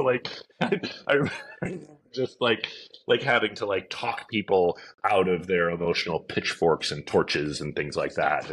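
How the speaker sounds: ordinary talk, nothing marked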